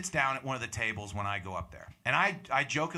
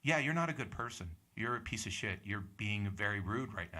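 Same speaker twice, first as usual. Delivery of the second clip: softer, lower, unruffled scolding; calm, even tone; 4 semitones lower, 6 dB quieter than usual. Retold or imitated. Comic replays telling the heckler off, calmly. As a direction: let it sink quietly, matter-of-fact. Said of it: "Like, at this tone".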